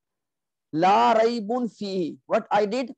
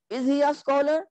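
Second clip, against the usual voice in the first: "is he a scholar" high with a rising end; question; 6 semitones higher than usual. Shown as a demonstration. Teacher translating the question, high rising "is he a scholar". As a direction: ask "is he a scholar", lifting the pitch. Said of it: "change your jumlatul ismiya into a questioning".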